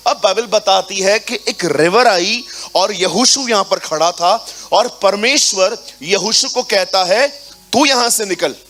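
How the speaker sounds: forceful preaching. Acted. Pastor preaching the Jordan crossing to the congregation, forcefully. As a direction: drive the scripture home to the church.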